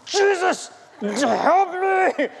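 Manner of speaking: high, strained plea, acted out; desperate cry for help. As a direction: re-enact a cramped, panicked call for help. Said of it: "That's how I was speaking".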